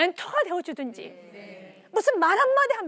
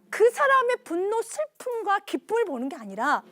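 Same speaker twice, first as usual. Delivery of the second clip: ordinary talk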